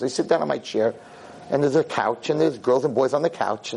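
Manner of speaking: ordinary talk, nothing marked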